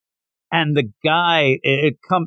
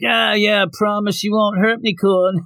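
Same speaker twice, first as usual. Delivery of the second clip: mocking bully; 6 semitones higher than usual. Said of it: "and they're like".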